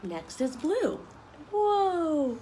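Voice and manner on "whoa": put-on big wide-eyed whoa for the kids; amazed whoa. Teacher showing children a new page, amazed whoa. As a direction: gasp of wonder at the page. Reading emotion book to kids.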